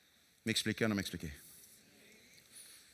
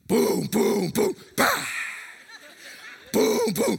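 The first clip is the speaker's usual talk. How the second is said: golpes fuertes imitados; 6 dB louder, much slower than usual. Retold or imitated. Imita a la esposa entrando fuerte.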